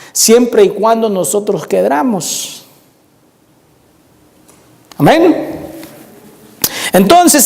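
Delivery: high, ringing sermon delivery; preaching. Acted. Preacher exhorting the congregation about forgiveness and humiliation, preaching. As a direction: preach it high, stretching the key phrases.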